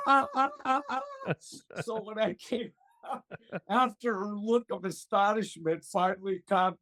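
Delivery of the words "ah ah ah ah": mock alarmed cries.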